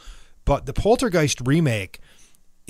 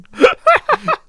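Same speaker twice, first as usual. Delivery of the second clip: falsetto laugh; high squealing laugh; 9 dB louder than usual. Retold or imitated. After singing falsetto bit.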